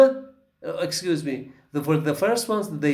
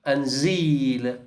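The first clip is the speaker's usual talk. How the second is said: seal and zeal drawn out very slowly; slow demo; much slower than usual. Shown as a demonstration. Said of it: "next to the right".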